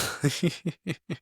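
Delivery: helpless laugh breaking off the sentence. Real, not acted. Speaker cracks up mid-story about the bus, laughing. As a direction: laugh instead of finishing the word.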